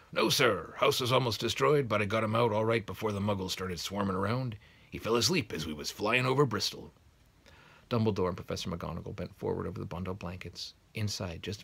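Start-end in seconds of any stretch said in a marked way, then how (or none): 0.1-6.9 loud gruff giant; big hearty voice; Hagrid voice. Acted.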